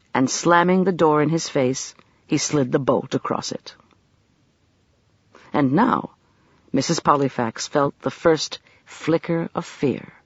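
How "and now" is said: plain reading voice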